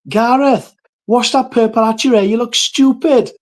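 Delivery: high, loud Welsh grandmother shouting out; mock scolding shout in broad Welsh; shouting, thick Welsh accent. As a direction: imitate a Welsh gran yelling across the room. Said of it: "she just shouts out, thick Welsh accent".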